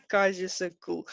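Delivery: chuckling between phrases; real laughing. Real, not acted. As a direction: let a laugh break into the talk. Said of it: "this is why I'm actually laughing now".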